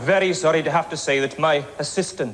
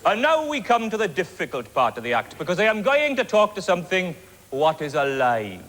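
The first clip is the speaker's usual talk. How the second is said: ordinary talk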